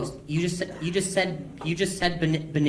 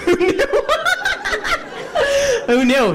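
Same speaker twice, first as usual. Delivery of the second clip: laughing; 4 dB louder than usual. Real, not acted. Laughing sarcastic exclamation.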